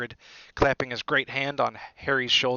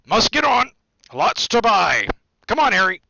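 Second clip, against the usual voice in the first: loud, high booming calls; shouting over babble; 9 semitones higher, 12 dB louder than usual. A narrator's reading. Hagrid hurrying Harry out of the noisy bar, booming shout. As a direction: bellow to cut through the crowd. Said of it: "make himself heard over the babble".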